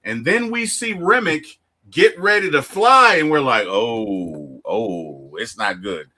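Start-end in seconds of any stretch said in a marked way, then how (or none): 3.7-6.1 low, soft mock dread; hushed dread. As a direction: sink low and quiet, imitating an uneasy viewer. Viewers dreading what comes next.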